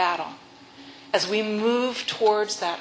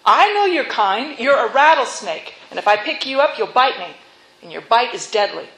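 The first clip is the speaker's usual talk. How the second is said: loud, raised warning voice; wary youth; 2 semitones higher, 6 dB louder than usual. Acted. Youth rebuffs snake in story.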